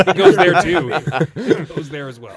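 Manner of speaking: giggling out the comeback; laugh-spoken confession about the rum. Real, not acted. Laughing at mouth joke.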